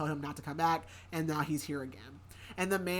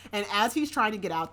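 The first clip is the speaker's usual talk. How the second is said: ordinary delivery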